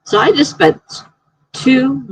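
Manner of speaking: talking with the hiccups; hiccupping between words. Real, not acted. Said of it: "I got the hiccups now".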